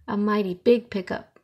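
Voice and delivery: plain reading voice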